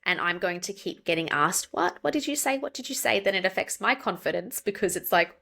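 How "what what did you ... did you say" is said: puzzled questioning. Retold or imitated. Imitates puzzled listeners asking repeatedly.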